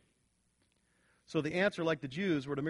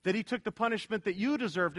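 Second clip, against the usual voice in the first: usual speaking voice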